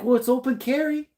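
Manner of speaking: high-pitched mock objection; playful know-it-all excuse, high; acted protest. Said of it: "You're like".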